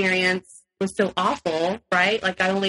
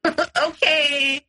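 laughing okay; 8 semitones higher than usual. Retold or imitated. Her meek reply to outburst.

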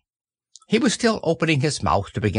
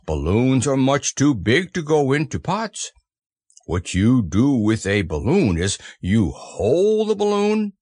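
earnest little lecture in a bear voice; explaining Pooh. Acted.

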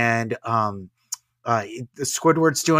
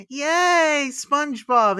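put-on high, loud squeal of a delighted child; excited kid; 13 semitones higher, 6 dB louder, much slower than usual. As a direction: cheer like a thrilled little kid. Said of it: "and the kid goes".

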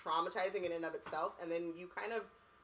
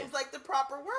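mock prim voice; 7 semitones higher, 4 dB louder than usual.

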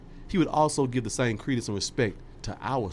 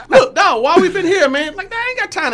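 exasperated shout; 12 dB louder than usual. Retold or imitated. Mock exasperated outburst.

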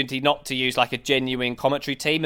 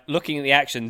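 ordinary talk, nothing marked

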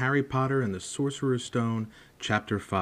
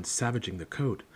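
plain reading voice

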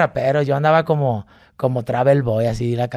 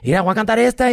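eager announcement; 8 semitones higher, faster than usual. Retold or imitated. Announcing song.

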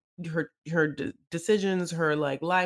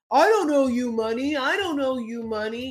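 mock Sean; 6 semitones higher, 5 dB louder than usual. Sean mocked refusing.

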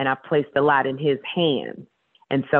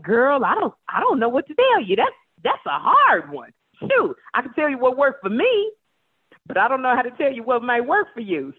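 higher, laid-back, no-advice tone; bemused shrug; 7 semitones higher than usual. Retold or imitated. Host answering the caller, higher bemused shrug. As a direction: tell her girl you've got nothing, amused. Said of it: "And then I was like".

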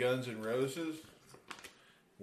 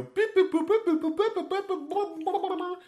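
rapid high-pitched imitation of wheel beeps; machine-like run of beeps and boops, far above normal voice; spinning wheel beeps; 5 dB louder than usual. Podcast host imitates the show's spinning wheel, high rapid beeping. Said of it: "it would go".

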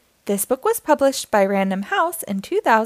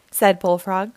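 ordinary delivery

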